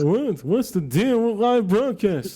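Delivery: high, loud, drawn-out stand-up comic voice; Jerry Seinfeld. Pretend. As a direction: stretch it out like an exaggerated observational comic. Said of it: "little Jerry Seinfeld just came out".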